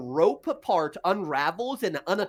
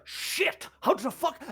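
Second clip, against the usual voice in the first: startled thug; 10 semitones higher, slower than usual. Acted. Thugs heard caught off guard.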